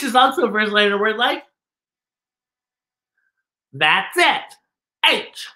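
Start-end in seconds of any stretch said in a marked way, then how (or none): none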